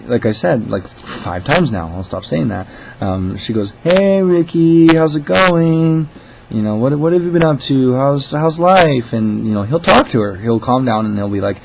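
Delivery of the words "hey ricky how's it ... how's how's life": high, loud, drawn-out cheery greeting; sing-song coaxing, as if to a special caller; manager humoring a caller. Retold or imitated. Manager greeting a regular caller, exaggerated friendly voice. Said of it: "she's great with handling these".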